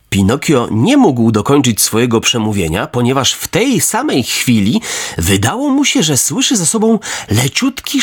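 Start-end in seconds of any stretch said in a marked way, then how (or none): none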